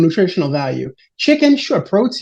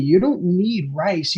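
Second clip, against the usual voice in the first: normal talking voice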